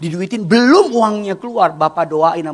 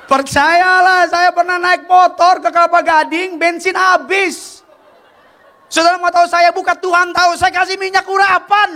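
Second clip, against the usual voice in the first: preaching at full force; 12 semitones higher, 4 dB louder than usual. Acted. The preacher testifies to the congregation about faith, in full preaching cry. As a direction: lift the whole church with it.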